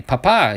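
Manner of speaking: Papa accented on its second A, pa-PA; stress on second syllable. Shown as a demonstration. Collector showing the Spanish word for daddy, Papa stressed on the end. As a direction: punch the final A of Papa. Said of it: "if you accent the second A".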